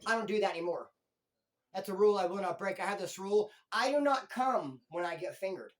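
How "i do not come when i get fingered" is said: ordinary delivery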